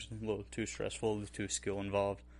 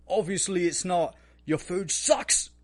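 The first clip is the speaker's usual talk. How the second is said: high, loud, hammed-up yelled insult; mock chef outburst; 10 semitones higher, 8 dB louder, slower than usual. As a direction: blast the owner like an enraged chef. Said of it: "And he's like".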